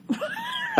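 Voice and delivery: high, soft burst of laughter. Real, not acted. Comedian cracking up at his own singing, high laugh. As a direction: crack up briefly.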